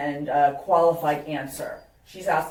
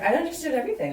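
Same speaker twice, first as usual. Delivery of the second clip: much higher quoted voice; emphatic reassurance; 11 semitones higher, much faster than usual. Retold or imitated. Woman insisting to her matchmaker she followed her date, emphatically. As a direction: brush the worry off, pitched way up.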